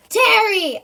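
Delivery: high, loud shout. A narrator's reading. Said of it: "I yell again, even louder".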